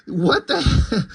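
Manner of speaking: breaking into a laugh mid-word; real laugh cutting off the words; laughing disbelief. Real, not acted. Cracks up repeating absurd dialogue.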